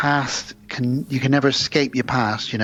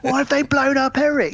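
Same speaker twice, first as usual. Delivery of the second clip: mock outraged cry; 12 semitones higher than usual. Mimicking Bob Hoskins' outrage.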